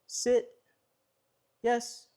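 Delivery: high, even command tone; same steady tone. Shown as a demonstration. Trainer demonstrating the steady command tone to viewers. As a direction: keep every command on one high pitch. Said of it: "You want your tone to be the same every time".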